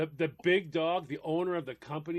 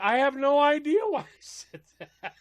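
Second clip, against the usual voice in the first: exasperated; 10 semitones higher, 9 dB louder than usual. Real, not acted. Exasperated at himself.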